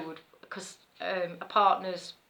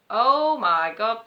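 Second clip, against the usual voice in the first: drawn-out, louder mock-shocked exclamation; retold alarm; 4 semitones higher, 8 dB louder, much slower than usual. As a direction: stretch it out loud, a touch of alarm. Retells spotting more bees.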